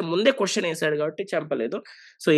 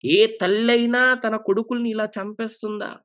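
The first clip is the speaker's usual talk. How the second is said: angry rebuke; 4 semitones higher than usual. Acted. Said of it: "ఎంత కోపం వచ్చేసిందంటే".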